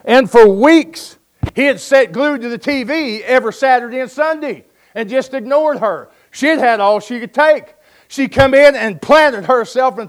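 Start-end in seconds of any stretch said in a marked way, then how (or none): none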